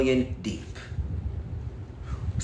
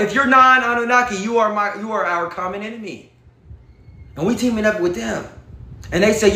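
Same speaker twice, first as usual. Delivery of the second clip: ordinary delivery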